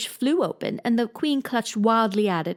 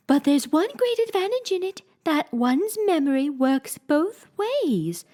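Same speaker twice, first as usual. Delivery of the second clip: high, slow fussy queen voice; lightly voiced queen, insisting; 8 semitones higher than usual. Queen to Alice, lightly voiced fussy queen.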